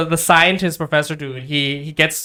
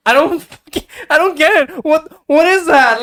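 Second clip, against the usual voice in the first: much higher, incredulous and amused; high-pitched laughing disbelief; laughing bewilderment; 10 semitones higher, 4 dB louder than usual. Real, not acted. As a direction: throw up your hands, baffled. Baffled by the racist characters.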